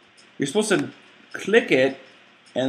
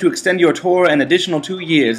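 letter read aloud; 5 semitones higher, 4 dB louder than usual. Acted. Reads the game letter aloud.

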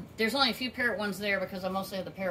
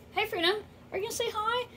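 much higher, quick coaxing coo; pet talk; 13 semitones higher, faster than usual. Real, not acted. Owner coaxing her parrot to say hi, in quick high pet talk. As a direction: coax the bird to speak up.

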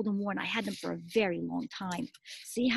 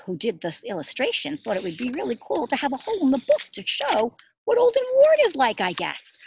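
ordinary talk, nothing marked

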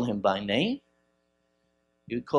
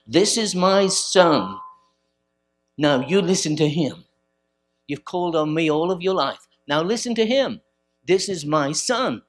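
voice from heaven; 4 semitones higher, 6 dB louder than usual. Retold or imitated. Preacher voicing God to the crowd, loud and commanding. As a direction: speak as heaven commanding them to listen. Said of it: "they heard a voice from heaven".

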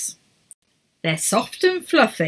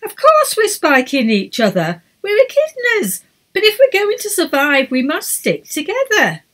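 exasperated leader; 10 semitones higher, 5 dB louder than usual. A narrator's reading. Edith scolding squabbling team.

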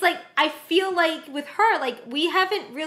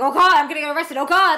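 louder, hammed-up alarm; mock panic; 8 dB louder, faster than usual. Viewer mimicking the character afraid of arrest, mock panic. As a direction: imitate someone fretting, louder.

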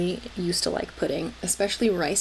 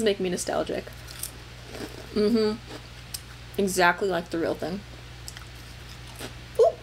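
chewing while talking; talking between bites; mouth full of crackers. Real, not acted. Seen 1: Bites small orange crackers before and during speech, mouth working.

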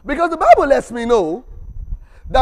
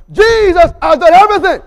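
shouted, much higher and louder; full preaching shout; 9 semitones higher, 11 dB louder than usual. Shown as a demonstration. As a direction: proclaim it at the top of your voice. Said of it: "One more time now".

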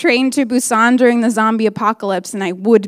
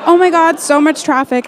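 fake excuse; 6 semitones higher, 4 dB louder than usual. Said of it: "I'd be like".

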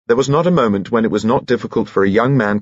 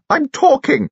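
high, indignant raised voice; shouting. A narrator's reading. Dowager rebukes lord for interrupting, shouting. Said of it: "shouted Lucy Lorimer".

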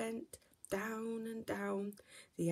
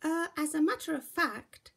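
higher, a touch smug; lightly voiced proud cub; 7 semitones higher, 6 dB louder than usual. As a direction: say it like a small bear with a secret. Baby Bear explains proudly.

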